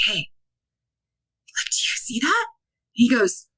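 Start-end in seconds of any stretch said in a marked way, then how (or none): 0.1-2.5 hushed unvoiced whisper; retold scared whisper. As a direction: breathe it out so no one hears. Whispering to partner in the dark.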